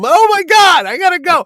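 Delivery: high, loud mock panic; panicked outburst. Husband caught by his wife flees his guests, loud comic panic. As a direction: yelp it and bolt.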